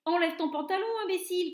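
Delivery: high, loud jeering call; mocking shout. A narrator's reading. Cook jeering at his colleague, high loud yell. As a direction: yell the advice across the kitchen. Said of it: "cria quelqu'un".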